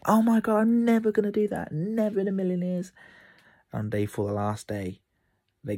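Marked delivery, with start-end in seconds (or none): 0.1-2.9 mock panicked kid. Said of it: "they're like".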